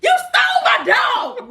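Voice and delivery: high laughing yell of a made-up accusation; mock angry accusation. Woman mimicking herself yelling at her man, high and laughing. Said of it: "when he pisses me off, I get to saying shit".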